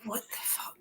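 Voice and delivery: barely audible aghast whisper; hushed retold reaction under the breath; whispered disbelief. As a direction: mouth it in a voiceless whisper. Aghast reaction to cringey singing.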